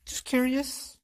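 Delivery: high, soft feigned innocence; a sly, high little "just curious"; mock innocent. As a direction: play it coy and high.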